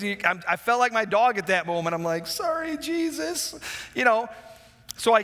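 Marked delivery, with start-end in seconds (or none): 2.3-3.6 meek, higher and softer; sheepish apology. Retold or imitated. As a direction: say it like a scolded dog with its tail down. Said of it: "you put your tail between your legs".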